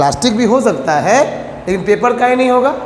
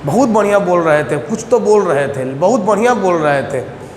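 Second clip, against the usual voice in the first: lower-voiced student vaguely praising the teacher; low, mock-impressed student reply; student answering mother; 5 semitones lower, faster than usual. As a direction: lightly act a clueless pupil reporting back. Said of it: "तुम बोलोगे".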